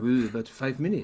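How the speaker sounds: mock French accent for a quoted staffer; lightly caricatured French accent; attempted French accent. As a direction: put on a silly French accent. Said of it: "he's, he's French".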